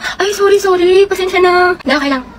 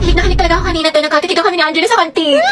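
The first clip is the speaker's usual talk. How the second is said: usual speaking voice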